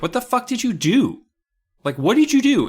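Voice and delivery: high, loud mock outrage; hammed-up shock pushing for answers; shocked friend. Margot demanding what Amy did, loud high outrage. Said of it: "And Margot's like".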